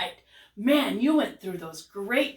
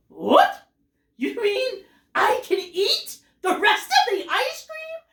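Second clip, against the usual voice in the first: loud "what?", then high, delighted disbelief; amazed, high-pitched questioning; incredulous question; 11 semitones higher, 5 dB louder, slower than usual. Acted. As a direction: ask it astonished, pitch climbing to the end. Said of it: "using what we call a question mark".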